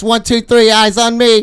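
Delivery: high, loud sing-song chant; kindergarten teacher voice. Pretend. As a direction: chant it like calling a class. Classroom attention chant.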